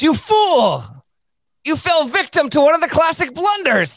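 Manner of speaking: high, loud, indignant lisping schemer; Princess Bride Vizzini. Retold or imitated. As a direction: scold like a smug Sicilian mastermind. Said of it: "Princess Bride vibes".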